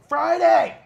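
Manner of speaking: very high, loud mock yell; shrieked wrestler impression; high-pitched shout. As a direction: imitate a squealed announcement at full volume. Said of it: "weird high-pitched voice".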